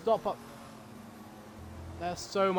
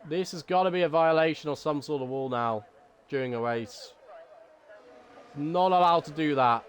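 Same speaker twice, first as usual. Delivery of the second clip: low-pitched, deliberately dampened play-by-play; subdued monotone race call; monotone commentary; 5 semitones lower than usual. Shown as a demonstration. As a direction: commentate low with the excitement held back. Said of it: "talk in a very monotone voice".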